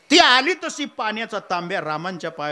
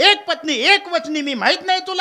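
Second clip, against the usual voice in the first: much higher, louder indignant cry; outraged; 12 semitones higher, 5 dB louder than usual. Acted. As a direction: burst out in shocked protest. Rama rebukes Hanuman, outraged.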